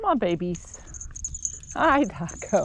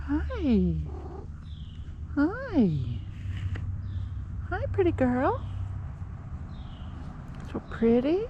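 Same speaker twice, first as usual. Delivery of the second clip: high cooing pet voice; 6 semitones higher, 4 dB quieter than usual. Real, not acted. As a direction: coo softly up high. Cooing to a cat.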